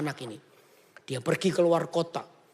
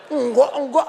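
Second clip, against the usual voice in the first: protesting father; 8 semitones higher, 7 dB louder than usual. Acted.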